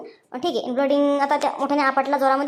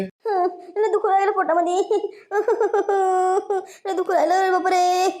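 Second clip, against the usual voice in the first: groaning in pain; 6 semitones higher than usual. Acted. Injured woman in pain.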